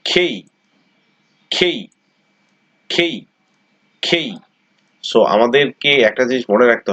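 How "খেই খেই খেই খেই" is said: খেই with aspirated খ and a gliding ই, pitched higher; correct letter name. Shown as a demonstration. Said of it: "এটা হচ্ছে খেই". (উঁচু স্বরে খেই, হাওয়া ছেড়ে)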